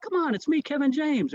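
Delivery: high-pitched retold protest; insistent. Pranked comedian pleads to be recognized, mock insistent.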